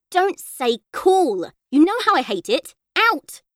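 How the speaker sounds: a mother losing patience with her son, angry; mum getting mad. A narrator's reading. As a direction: scold him like a fed-up mum. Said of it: "Then she got really mad".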